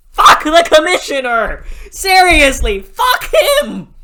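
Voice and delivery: loud, high shouting, breaking into a laugh at the end; furious yell. Real, not acted. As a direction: scream it at him, half laughing. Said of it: "I'm still pissed about this".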